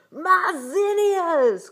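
very loud, high, drawn-out wail; outraged cry. A narrator's reading. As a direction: bellow in horror for ruined flowers. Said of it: "cried Lamar".

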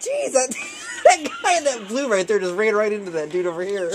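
high, amused telling through giggles; laughing. Real, not acted. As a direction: still laughing as you point it out. Laughing at game collision.

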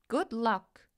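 usual speaking voice

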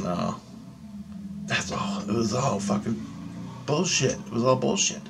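quiet reassurance to self; dismissive self-talk. Retold or imitated. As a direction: mumble it to yourself as a dismissal. Telling himself it was not real.

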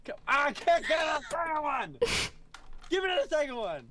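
frustrated yelling. Real, not acted. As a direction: yell at the game in frustration. Raging at game.

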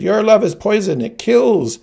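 put-on high, loud childish outburst; anguished cry, pitched high and loud; bitter accusation. A narrator's reading. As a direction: hurl it at the mother in anguish. Dying child accuses his mother.

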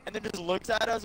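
ordinary talk, nothing marked

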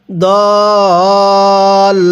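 দল with the vowel held four alif counts; long madd on dal. Shown as a demonstration. Teacher showing students দল with a four-count pull. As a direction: stretch the vowel of দল long and steady. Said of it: "দদের মধ্যে চার আলিফ টান দিব".

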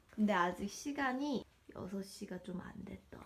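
soft whispered aside; hushed, barely voiced. Real, not acted. Whispering to dog. (속삭이듯)

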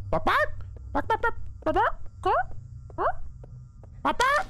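high squeaky bark bark noises; mock chicken barks. Streamer squeaking fake chicken talk at the game's chicken. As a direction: pretend to speak chicken to the monster. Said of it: "I speak chicken".

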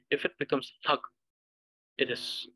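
usual speaking voice

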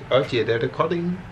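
ordinary talk

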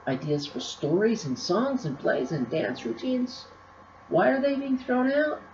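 excited listing, leaping high into the question; gleeful. A narrator's reading. Bookie plowing through baskets of ideas, gleeful. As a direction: marvel at each find, then pipe up high. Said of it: "shouted Bookie in glee".

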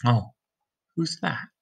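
ordinary delivery

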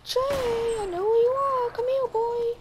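high squeaky calling; high-pitched playful taunt; high Elmo-like voice. Pretend. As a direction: call him over in a puppet voice. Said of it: "Do I sound like Elmo?".

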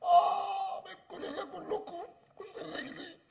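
high, slow, faint sobbing curse; crying in pain. Acted. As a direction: whimper it through tears. Hurt, cursing everyone.

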